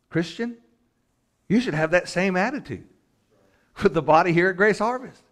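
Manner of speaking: high, loud preaching; forceful exhortation. Real, not acted.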